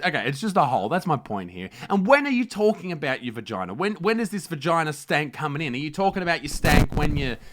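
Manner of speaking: loud, high, brash parody newsreader; host a trashy news show with swagger; mock news anchor.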